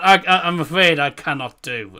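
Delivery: ordinary talk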